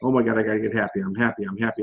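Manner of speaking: low, quiet, anxious self-talk, pushing the words; a strained, low chant of I'm happy at yourself; forced desperate repeating. Shown as a demonstration. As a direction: mutter it like you're trying to force yourself happy. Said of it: "But repeat it not like".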